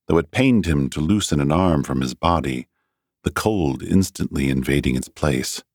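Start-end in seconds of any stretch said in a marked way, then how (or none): none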